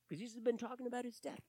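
ordinary talk, nothing marked

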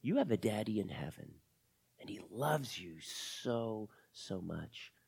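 low, soft, slowing toward a hush; tender reassurance. Acted. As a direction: let the comfort sink in softly. Lizzie assures Camila she is loved.

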